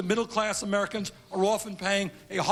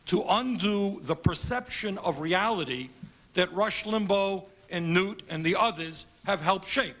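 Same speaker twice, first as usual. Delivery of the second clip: ordinary delivery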